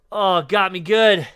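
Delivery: slow, loud pained groan; groaning through a cramp. Real, not acted. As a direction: push the words out through cramp pain. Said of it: "that was a good cramp".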